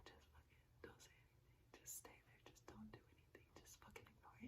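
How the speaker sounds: tense whisper to herself; whispering through the anger; whispered self-talk. Retold or imitated. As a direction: breathe it out under your breath to stay calm. Whispering to herself to keep calm.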